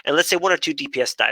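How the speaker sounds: usual speaking voice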